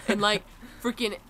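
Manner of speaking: normal talking voice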